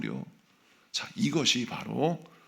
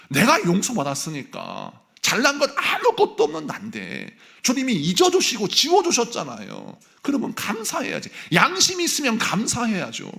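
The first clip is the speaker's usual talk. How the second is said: high, loud fervent preaching, a laugh at the start; preaching at full force; 9 semitones higher, 6 dB louder than usual. Acted. Pastor urging the congregation to be grateful, fervent and loud. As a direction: press the grateful conscience home.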